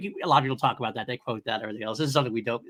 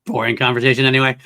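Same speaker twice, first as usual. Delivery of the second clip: Han Solo quote; 9 dB louder than usual. Retold or imitated. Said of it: "That's my follow-up".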